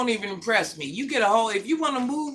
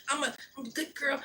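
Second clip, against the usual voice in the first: much softer, quick and sugary; mock soft voice; 3 semitones higher, 10 dB quieter, faster than usual. Mocking friends who go soft for a man, sugary. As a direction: coo it like a fake good girl. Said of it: "they got the soft voice".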